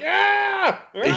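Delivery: high-pitched mock scream; imitated scream. Podcaster mimics the old woman with vomit on her arm, mock screaming. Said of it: "she starts screaming".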